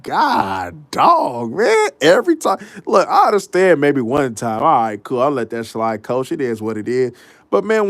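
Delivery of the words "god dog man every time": incredulous, swooping complaint; exasperated disbelief. Retold or imitated. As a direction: voice leaping high in exasperation. Exasperated reaction to repeated crying.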